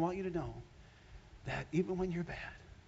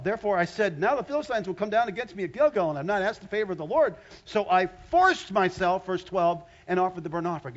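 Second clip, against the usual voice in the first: forceful scripture reading; 3 semitones higher, 6 dB louder than usual. Acted. Pastor reading Saul's words to church, forceful reading. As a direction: read it out like a sermon.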